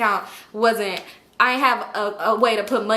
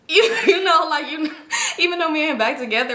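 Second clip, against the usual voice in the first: laughing; 7 semitones higher than usual. Real, not acted. Laughing while telling viewers about the jail news.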